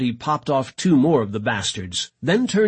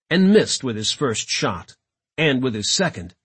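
usual speaking voice

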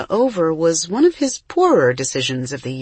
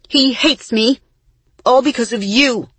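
raised, loud, pitched-up blame; angry accusation; 7 semitones higher, 5 dB louder than usual. A narrator's reading. Isa blaming her twin over Benny, angry. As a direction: throw the blame at the listener.

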